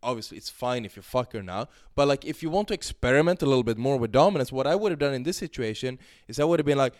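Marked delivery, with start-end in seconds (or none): none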